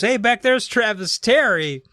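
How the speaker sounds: high, slow announcement to the room; stage call-out. Retold or imitated. Comic on stage pointing out Travis, calling out. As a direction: call it out across a club.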